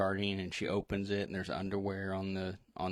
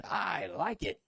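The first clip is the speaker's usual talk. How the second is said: high-pitched catchphrase imitation; high put-on film-quote voice; RoboCop movie quote; 10 semitones higher, slower than usual. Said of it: "do a quote from RoboCop".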